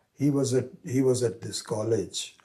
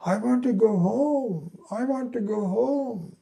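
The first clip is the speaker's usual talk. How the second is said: much higher, half-shouted delirious cry; shouting to go home; 12 semitones higher, 4 dB louder than usual. Retold or imitated. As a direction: wail it out, pitched way up. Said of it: "He kept shouting".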